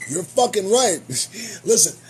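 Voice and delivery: normal talking voice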